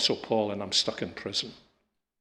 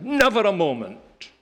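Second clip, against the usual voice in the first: NEVER high and loud, then falling; forceful stress on "Never"; emphatic repeat; 8 semitones higher, 5 dB louder than usual. Real, not acted. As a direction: hit "Never" hard at a raised pitch.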